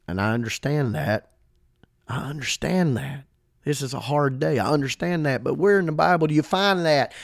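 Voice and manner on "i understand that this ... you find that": normal talking voice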